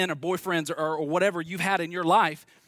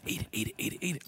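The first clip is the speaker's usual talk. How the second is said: hushed tempting whisper; whispering snake; 3 semitones lower, 7 dB quieter, faster than usual. Acted. Snake tempting Eve in a hushed whisper. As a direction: coax her like a snake.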